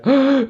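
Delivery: high-pitched fake sob; mock crying wail. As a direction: wail like a bawling cartoon child. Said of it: "la Chilindrina llegaba llorando". (llanto fingido y agudo)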